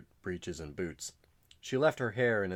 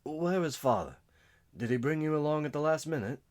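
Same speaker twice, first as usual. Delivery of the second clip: higher, louder, fast worried questions; anxious brother; 9 semitones higher, 4 dB louder than usual. Acted. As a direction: rush out the worry. Wounded brother anxiously asking.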